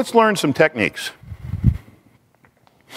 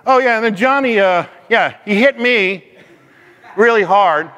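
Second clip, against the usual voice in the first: put-on higher-pitched child making up a tale; put-on kid's complaint, pitched up and halting; kid tattling; 6 semitones higher than usual. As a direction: imitate a kid reporting being hit, pausing to invent. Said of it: "But when your kid tells you".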